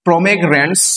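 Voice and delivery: ordinary talk, nothing marked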